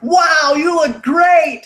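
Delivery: high, loud gushing compliment; mock rave; gushing praise. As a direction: gush over how great she looks. Everyone praising her wig.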